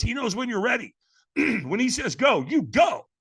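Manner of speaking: ordinary delivery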